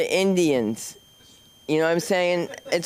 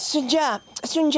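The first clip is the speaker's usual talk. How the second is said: much higher calling out a name; mother calling; 11 semitones higher than usual. Retold or imitated. Mother calling her daughter from downstairs, high and sing-song. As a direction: call her across the room like mom. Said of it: "she goes".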